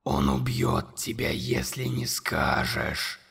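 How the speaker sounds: very low, slow, whispered monster voice. A narrator's reading. Monster whispering accusations to Conor, low and slow. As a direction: loom over the boy and breathe every word. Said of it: "объявило чудовище".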